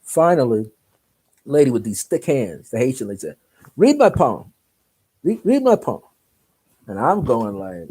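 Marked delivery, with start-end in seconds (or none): 3.8-6.0 high, loud, insistent order; insistent demand. Retold or imitated. Haitian lady demands a palm reading, insistent. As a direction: press it as a high-pitched demand.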